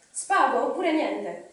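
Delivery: loud, drawn-out cry of a desperate guess; Gollum shriek. A narrator's reading. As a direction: cry it out slowly and loudly. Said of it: "strillò Gollum".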